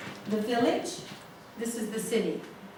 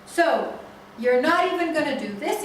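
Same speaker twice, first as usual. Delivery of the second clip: angry; 5 semitones higher, 6 dB louder than usual. Retold or imitated. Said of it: "says the father".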